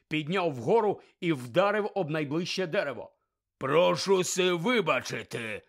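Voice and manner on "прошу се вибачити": loud slow angry snarl; big man snarling in fury. Acted. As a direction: bark a menacing demand. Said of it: "гаркнув він".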